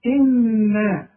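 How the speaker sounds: إنا with a long humming nun; nasal ghunna. Shown as a demonstration. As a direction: hold the doubled nun of إنا. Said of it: "أذكرك بالنون". (إنا مع غنة ممدودة)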